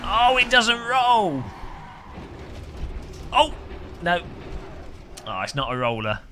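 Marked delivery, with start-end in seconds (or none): 0.0-4.2 high, slow lament; dismayed groan. Real, not acted. Disappointed when car won't roll.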